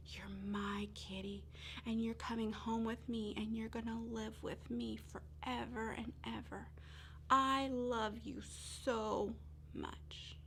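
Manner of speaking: soft, slow whispered love; tender whisper. Acted. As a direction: hush it to the kitten in your arms. Girl hugging kitten tenderly.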